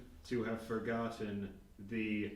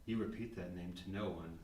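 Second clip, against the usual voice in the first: softer, fast low-key menace; quiet threat; 5 semitones lower, 7 dB quieter, faster than usual. Acted. Barnaby warning the reporter to keep his name secret, a quiet threat. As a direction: threaten under your breath.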